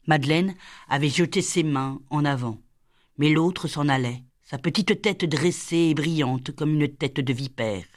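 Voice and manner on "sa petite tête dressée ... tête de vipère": ordinary delivery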